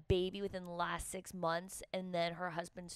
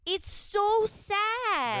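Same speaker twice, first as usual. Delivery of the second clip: high, loud, drawn-out lament; big whiny protest; exaggerated wail; 13 semitones higher, 8 dB louder, much slower than usual. Real, not acted. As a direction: wail it slowly, stretching each word. Protesting about sad music.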